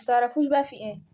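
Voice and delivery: ordinary talk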